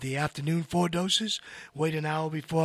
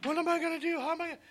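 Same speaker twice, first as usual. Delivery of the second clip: high, fretful imitation of a client; worried asker; 11 semitones higher, much faster than usual. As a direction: mimic the anxious question in a high voice. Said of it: "People ask me that all the time".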